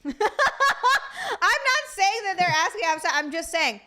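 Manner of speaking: laughing through the words, slow; real laughter breaking the line. Real, not acted. Woman laughing while defending herself to her friend.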